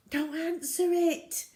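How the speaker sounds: high, urgent plea; imploring. Acted. Zoe begging her dad not to answer, high and urgent. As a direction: beg him not to pick up. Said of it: "she implored".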